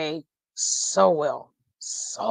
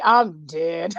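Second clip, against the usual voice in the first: ordinary talk, nothing marked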